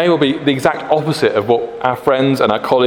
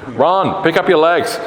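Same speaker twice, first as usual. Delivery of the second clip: higher, mock coach's shout; shouting PE teacher; 7 semitones higher, slower than usual. PE teacher to pupils on a wet track, mock yell. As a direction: imitate a PE teacher urging runners on. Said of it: "my Irish P E teacher shouting".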